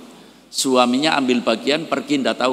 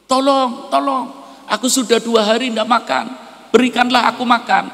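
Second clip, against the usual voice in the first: much higher, strained begging voice; starving beggar pleading; 11 semitones higher, 3 dB louder than usual. Acted. Starving beggar at the new couple's door begs, high and strained. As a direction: plead for food as if fainting from hunger.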